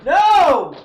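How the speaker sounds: high, loud falsetto shriek of shock. Acted. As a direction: scream in mock horror at what you just heard. Shocked outburst at the clip.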